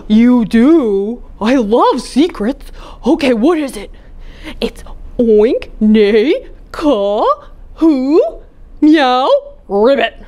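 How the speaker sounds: Dog loving secrets. Acted. Dog's distinct voice.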